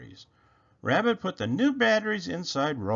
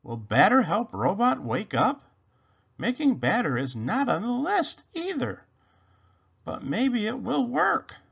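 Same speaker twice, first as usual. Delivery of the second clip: a touch higher, wondering bunny voice; a hint of a hopeful little rabbit; lightly voiced Rabbit; 8 semitones higher than usual. A narrator's reading. Rabbit puzzling over the batter clue, lightly voiced.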